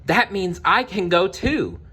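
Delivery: put-on bright eager young girl asking; hopeful Cinderella. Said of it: "Cinderella said".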